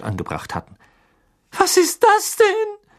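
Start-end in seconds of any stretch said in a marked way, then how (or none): 1.5-2.9 high, slow frightened gasp; gasping in fright. A narrator's reading. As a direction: gasp it out in shock. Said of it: "keuchte Tante Petunia".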